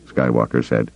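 ordinary delivery

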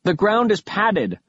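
high, carrying voice without much force; calling up. A narrator's reading. Alcatraz reports to Kaz from the pit, calling up. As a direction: call it up the shaft. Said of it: "I called up to Kaz".

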